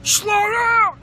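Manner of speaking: loud, high yell. Pretend. Yelling at a slow driver to slow down. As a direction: bark it at the driver ahead. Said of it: "No, I just".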